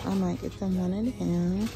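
normal talking voice

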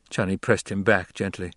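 usual speaking voice

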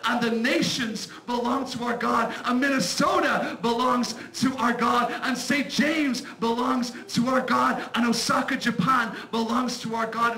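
loud, building sermon voice; fiery preaching. Acted. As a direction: preach it to the congregation. Preaching at full force.

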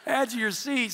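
high, loud call to the crowd, last words slow; rousing shout. Real, not acted. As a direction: fire up the congregation. Preacher rouses laughing congregation.

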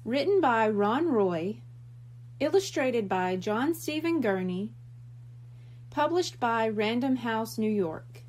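usual speaking voice

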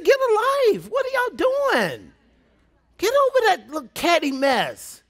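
high-pitched, exasperated squeal; exasperated scolding. Retold or imitated. Preacher telling catty squabblers off, exasperated. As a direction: scold them in comic disbelief.

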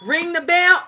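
ordinary talk, nothing marked

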